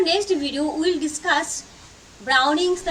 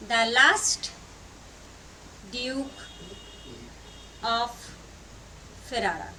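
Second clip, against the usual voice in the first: long pauses between the words; halting pace of someone writing along; word-by-word dictation; 3 semitones lower, 5 dB quieter than usual. Real, not acted. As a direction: spell out the title one word at a time.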